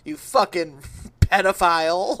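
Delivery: loud laughing mock yell at the listener; shouting insults half laughing. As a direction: yell it like an unhinged podcaster. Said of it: "And he's like".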